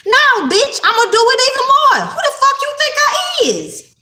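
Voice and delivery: high laughing shout, tossing it back at them; laughing defiant shout. Real, not acted. Laughing high shout at her detractors who doubted her.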